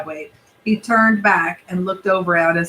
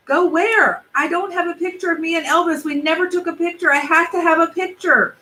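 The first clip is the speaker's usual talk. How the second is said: yelled; 9 semitones higher than usual. Acted. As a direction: shout it out, urgent. Boy pleading with mom.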